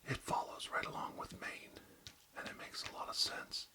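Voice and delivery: ASMR whisper, close to the mic; barely audible, intimate whisper. Acted. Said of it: "real close to inaudible whispers today".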